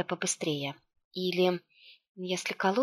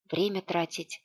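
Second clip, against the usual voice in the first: plain reading voice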